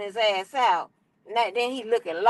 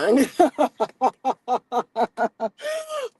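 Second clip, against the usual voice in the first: hearty laugh; 3 semitones higher than usual. Real, not acted. Laughing at her own joke, hearty laugh. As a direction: burst out laughing in a long run.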